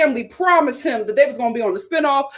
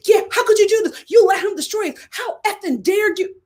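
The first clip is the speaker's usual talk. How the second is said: hammed-up angry wife; mock cussing out; 7 semitones higher than usual. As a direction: imitate the wife tearing into him. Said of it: "She starts cussing out Chuck too".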